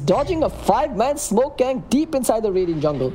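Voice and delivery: high, excited play-by-play rising fast; gushing. Real, not acted. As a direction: caster losing it over a great play. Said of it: "I'm sorry if I sound like I'm gushing".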